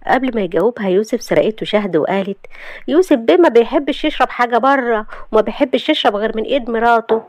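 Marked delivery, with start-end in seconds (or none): 2.9-7.2 wife marking territory before the attendant, sugary and higher; possessive sweetness. Acted. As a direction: sweetly stake your claim in front of the rival. Wife claims husband before attendant.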